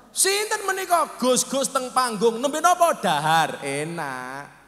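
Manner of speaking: calling out questions to a crowd; mock dialogue. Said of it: "Ngono beda-beda". (takon seru)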